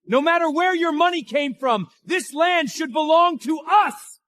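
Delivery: high, insistent outcry; outraged demand. Acted. Relative demands the land for themselves, high and outraged. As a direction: push it high, building to a shout.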